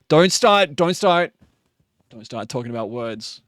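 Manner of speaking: normal talking voice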